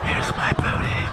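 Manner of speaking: slow, voiceless whisper; creepy whisper. Acted. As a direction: whisper it slowly to the stranger. Squeezing past a stranger, whispered repeat.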